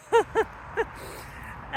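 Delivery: squeaky gasping laugh in the cold; high breathless laugh. Real, not acted. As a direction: let out a high breathless giggle. Reacts to freezing rain.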